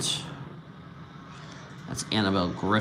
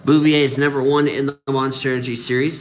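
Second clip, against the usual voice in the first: louder, higher commentator call; cranked up; 5 semitones higher, 6 dB louder than usual. Real, not acted. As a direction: punch it out like a live race caller. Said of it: "crank it up".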